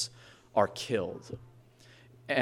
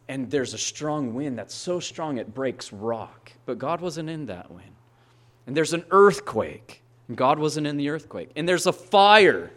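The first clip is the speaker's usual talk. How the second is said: ordinary delivery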